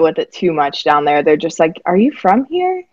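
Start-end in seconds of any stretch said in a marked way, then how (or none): 1.8-2.8 higher-pitched imitation of puzzled locals; mock doubtful question. Guest imitates folks back home questioning the accent, mock doubtful. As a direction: lift it into a mock incredulous question.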